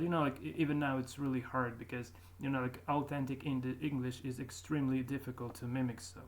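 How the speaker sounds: ordinary delivery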